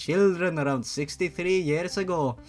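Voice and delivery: a lightly put-on Scottish-Irish lilt for the old witch; old shop witch in a Scottish-Irish brogue; hint of an Irish brogue; Scottish-Irish accent. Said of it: "I sound more like fucking Scottish or Irish".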